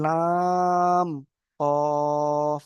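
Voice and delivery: very slow recitation of letter names; drawn-out letter recitation. Shown as a demonstration. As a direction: stretch each letter name long and sung. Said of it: "আসলে কি পড়া".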